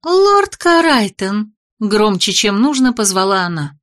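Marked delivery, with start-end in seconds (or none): 0.0-1.5 high, loud, drawn-out call; piercing call. Acted. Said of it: "пронзительный голосок".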